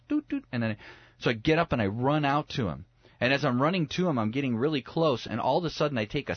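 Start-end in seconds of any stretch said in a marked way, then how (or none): 0.1-0.5 imitated high-pitched alert beeps; two high vocal blips; radar beeps. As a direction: mimic a little radar going off. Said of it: "My radar goes".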